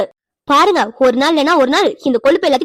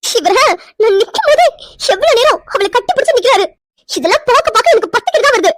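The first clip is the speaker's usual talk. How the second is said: tearful outrage; 7 semitones higher than usual. Acted. Wife crying as husband hugs her sister, tearful outrage. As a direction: sob out the hurt and anger.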